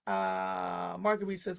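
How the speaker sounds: ordinary talk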